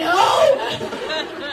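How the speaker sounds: very loud, high mock-outraged yell; comically over-the-top shout; screamed denial. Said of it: "Are you screaming at people again?".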